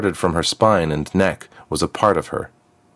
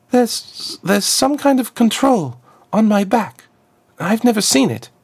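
put-on much higher, stammering girl's voice; flustered girl. Freed girl tells Azriakam about the control on her back, high and flustered. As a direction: stumble over the first words, unsure.

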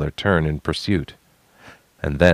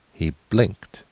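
ordinary delivery, nothing marked

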